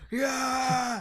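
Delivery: high-pitched rallying cheer; army cheer. Acted. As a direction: cheer back like the troops. Troops cheering their commander.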